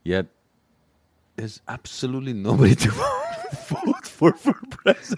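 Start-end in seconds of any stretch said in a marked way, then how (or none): 1.4-5.2 incredulous. Real, not acted. Exasperated disbelief.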